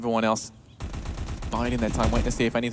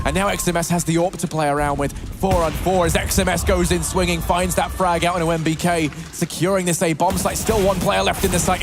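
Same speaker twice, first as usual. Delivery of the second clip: lively play-by-play call; esports caster; 5 semitones higher, 4 dB louder than usual. Acted. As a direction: call the action with energy. Hyped call of a kill.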